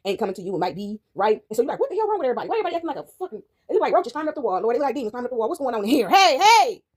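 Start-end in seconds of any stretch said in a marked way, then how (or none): 1.8-6.8 mock alarm, rising to a shouted "hey"; acted bewilderment. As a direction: act baffled, then call out sharply. Acts out bewildered outburst at everybody.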